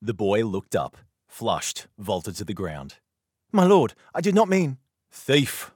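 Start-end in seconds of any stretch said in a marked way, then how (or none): 3.5-4.8 put-on much higher and louder, a startled boy's excuse; a caught boy blurting out an apology. 5.1-5.7 higher, louder mock-stern accusation; trying to sound stern. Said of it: "trying to sound stern".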